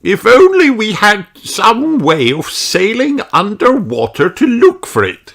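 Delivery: high, wistful fairy king voice; sighing king. Acted. King Thistle wishes for an underwater boat. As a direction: sigh it wishfully as the king. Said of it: "sighs King Thistle".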